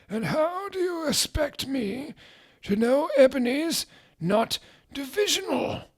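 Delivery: reedy high wizard voice; high old wizard. Acted. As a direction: play a doddering headmaster, mocking. Dumbledore voiced.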